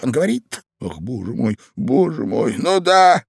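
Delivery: high, agitated exclamations; fretting that bursts out loud at the end; fretful Ivan. A narrator's reading. Ivan listening to the old man, agitated. Ivan frets under the bed.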